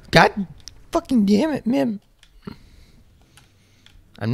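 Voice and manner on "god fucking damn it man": high-pitched angry yell at the game; frustrated outburst. Real, not acted. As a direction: let the frustration explode. Frustrated raging at dying.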